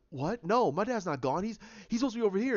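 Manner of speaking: rushed, rising protest in a retelling; disbelieving denial. Retold or imitated. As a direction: act out refusing bad news, voice climbing. Retold daughter refusing news of death.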